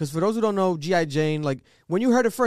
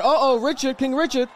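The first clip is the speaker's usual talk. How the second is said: lightly mimicked taunt, higher pitched; imitating a quote; 5 semitones higher than usual. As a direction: repeat his words with raised, playful pitch. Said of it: "Oh, he said".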